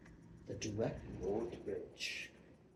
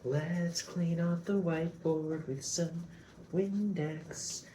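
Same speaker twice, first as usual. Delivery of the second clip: slow, higher sing-song, lightly done; 7 semitones higher, 5 dB louder, much slower than usual. Shown as a demonstration. New mom cooing over cleaning, in a lightly slow higher sing-song. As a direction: coo it like to a baby, a touch of it. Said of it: "that's why I have a sing-song voice".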